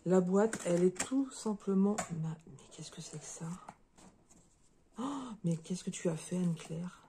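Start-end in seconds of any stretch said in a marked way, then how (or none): 2.2-3.4 breathless, whispered wonder; whispered astonishment at the gift inside the box; amazed whisper. Real, not acted. 5.0-7.0 awed and hushed. Real, not acted. Overwhelmed by the handmade gift.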